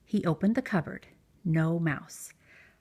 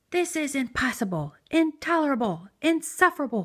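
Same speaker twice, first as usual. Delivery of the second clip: angry build; 7 semitones higher than usual. A narrator's reading. Bear shaking with anger at the mouse, building cry. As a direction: climb to a furious cry. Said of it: "cried Bear".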